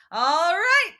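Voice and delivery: loud, high, sing-out goodbye; mock breezy farewell. Chiron leaving camp, loud mock-breezy goodbye. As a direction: belt out a hammed-up send-off.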